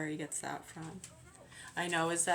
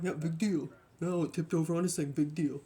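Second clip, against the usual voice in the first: mocking dismissive; 3 dB louder than usual. Mocking careless person's dismissal.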